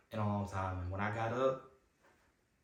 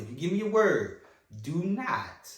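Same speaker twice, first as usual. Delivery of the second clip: retold warning; 6 semitones higher, 6 dB louder than usual. Retelling a warning to the Lord.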